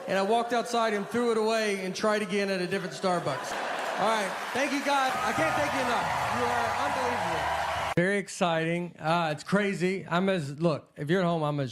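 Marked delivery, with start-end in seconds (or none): 4.0-7.4 excited thanks. Real, not acted. Thanks the cheering audience.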